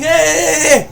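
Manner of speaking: high, loud mock yell of the owner; owner's shout. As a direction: shout the way the owner would catch the thief. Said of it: "The, the, the owner starts screaming".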